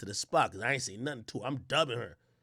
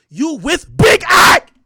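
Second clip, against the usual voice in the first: slow, much louder, higher shout; loud boast; 9 semitones higher, 15 dB louder, much slower than usual. Retold or imitated. Narrator shouting his own name at his date. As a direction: drag out the brag.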